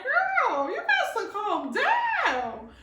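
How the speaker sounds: high then slow mock scolding; calming herself. Telling the Holy Spirit to calm down, high and slow. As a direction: tell yourself to settle, slowly.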